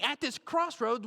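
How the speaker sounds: usual speaking voice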